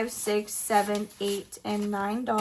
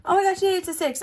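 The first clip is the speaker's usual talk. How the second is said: gasp, then high, loud delight; delighted surprise; 8 semitones higher, 4 dB louder, faster than usual. Real, not acted. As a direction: burst out higher with happy surprise. Happy surprise at revealed number.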